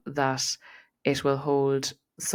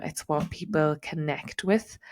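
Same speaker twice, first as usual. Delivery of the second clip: ordinary talk, nothing marked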